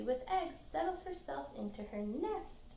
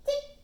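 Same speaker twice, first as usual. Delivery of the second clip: one tiny, high tick; very high, short bird sound; hummingbird chirp; 7 dB louder than usual. A narrator's reading. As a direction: squeak once like a hummingbird. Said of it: "in her high voice".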